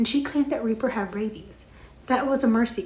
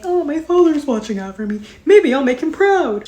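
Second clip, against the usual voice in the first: annoying man voice; 5 semitones higher, 7 dB louder than usual. Retold or imitated. Reviewer mocking Snow with her annoying-men voice. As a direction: play him as a self-important pompous guy. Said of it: "the voice that I use for annoying men".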